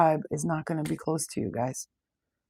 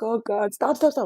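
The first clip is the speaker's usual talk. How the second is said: higher, louder, begging it to stop; pleading outcry; 6 semitones higher, 5 dB louder than usual. Retold or imitated. Psychic recalls crying out to spirits in pain while driving, higher and louder. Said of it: "I was like".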